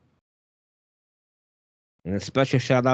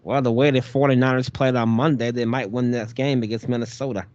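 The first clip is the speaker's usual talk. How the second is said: ordinary delivery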